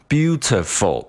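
beautiful in choppy Chinese-accented English, syllables equally short. Shown as a demonstration. Teacher shows students what to avoid, choppy Chinese accent. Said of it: "你不能读成beautiful".

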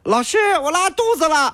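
拔高嗓门、拖长声音模仿小学生; 装成小孩高声向老师报告; 学生喊老师. Acted. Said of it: "小的时候我们经常编的理由". (学小学生编借口的高声喊叫)

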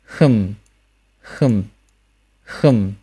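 "hm" said as a short unrounded vowel closing to m; isolated careful "hm", falling hummed ending; final m demonstration. Shown as a demonstration. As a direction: close both lips to end each "hm". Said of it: "第五个，h".

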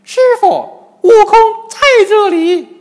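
very high, piercingly loud Monkey King cry; loud answering call. A narrator's reading. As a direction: shout back to your master at full voice. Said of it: "金蝉老妖大声答应". (大声高喊着答应)

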